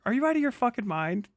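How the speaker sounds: ordinary talk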